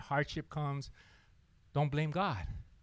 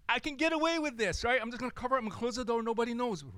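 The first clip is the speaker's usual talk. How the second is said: higher, quick cocky voice; reckless younger self; 8 semitones higher, faster than usual. Retold or imitated. Young therapist sneaking a smoke, quick and higher. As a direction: rush it like you're sure you'll get away.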